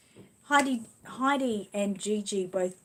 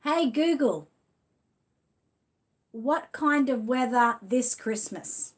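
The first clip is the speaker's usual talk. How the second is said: slow, loud, higher words for the speaker; slow command; 3 semitones higher, 5 dB louder, slower than usual. Real, not acted. Third try asking Google about Christmas, slow loud command. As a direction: spell it out slowly so the machine gets it.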